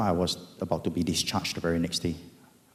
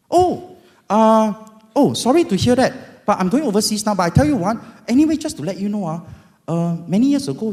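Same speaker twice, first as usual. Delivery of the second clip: much higher, loud chatty agent; insurance agent; 9 semitones higher, 6 dB louder than usual. Retold or imitated. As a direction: rattle off good news brightly. Voicing the agent on phone.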